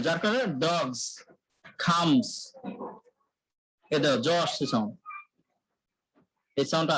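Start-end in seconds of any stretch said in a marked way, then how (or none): 0.6-2.3 ডগস and খামস ending in z; z ending demo. Shown as a demonstration. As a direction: stress the z at the end of ডগস. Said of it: "জ আসবে". (শেষে z দিয়ে ডগস, খামস)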